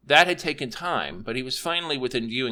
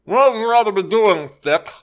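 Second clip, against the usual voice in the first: talking through a mouthful of sandwich; mouth full; 10 semitones higher, 11 dB louder than usual. A narrator's reading. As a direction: speak round a bite of food. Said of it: "speaking with his mouth full".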